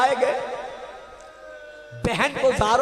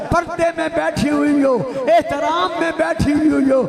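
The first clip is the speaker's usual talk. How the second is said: louder and higher, building into a wailing lament; preaching at full force; 5 semitones higher, 4 dB louder than usual. Acted. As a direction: climb from fervent address to a sobbing cry of grief. Majlis lament rising to climax.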